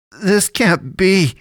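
stunned disbelief. A narrator's reading. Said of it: "he muttered".

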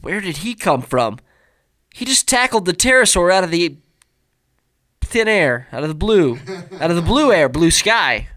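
loud, high and excited. Real, not acted. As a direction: tell it like it's thrilling. Excited retelling of tackle scene.

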